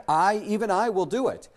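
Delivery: "I, even I" hit high and loud; emphatic. Retold or imitated. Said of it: "He makes it emphatic".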